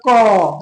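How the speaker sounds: क said slowly, ending in an open अ sound; slow, drawn-out क so the अ at the end is heard; clear क sound. Shown as a demonstration. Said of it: "थोड़ा क को बोल के देखिएगा ना". (क को धीरे बोलें, अंत में अ साफ़ सुनाई दे)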